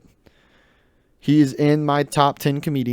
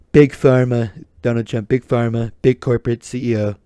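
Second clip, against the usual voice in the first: Donald Trump impression; 4 semitones lower than usual. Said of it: "doing the Don".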